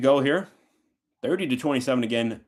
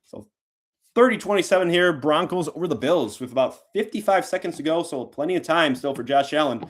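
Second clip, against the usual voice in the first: live play-by-play call; 2 semitones higher than usual. Real, not acted. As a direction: ring out "straight down the middle and true". Calls the extra point live.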